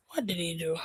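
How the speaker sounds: hushed, breathless disbelief; shocked whisper. Real, not acted. Reacting to the video in a stunned whisper. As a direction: whisper it like you can't believe it.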